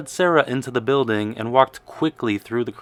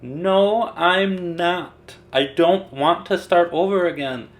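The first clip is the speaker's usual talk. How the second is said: higher, louder whining protest; whiny child; 5 semitones higher, 4 dB louder, slower than usual. A narrator's reading. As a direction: whine it from under the covers. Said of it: "Sarah said".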